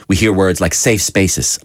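ordinary talk

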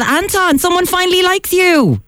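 high excited teasing call. Real, not acted. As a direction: call out with glee. Host calls out to Anton.

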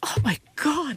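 overwhelmed, trying to go on; real tears, breath catching; choked up. Real, not acted. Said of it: "I've never actually teared up about this".

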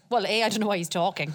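ordinary talk

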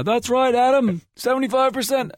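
high cartoon newsreader voice; hammed-up kids' show host; mock TV anchor.

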